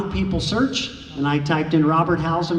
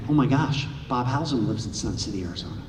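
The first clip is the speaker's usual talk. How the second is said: much softer, fading down to a hushed breath; awed hush; 5 semitones lower, 5 dB quieter than usual. Retold or imitated. Fan seeing the caller ID, softer in awed disbelief. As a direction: say it like you can't believe who's calling. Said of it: "I start shaking".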